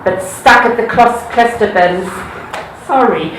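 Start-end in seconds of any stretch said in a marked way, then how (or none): none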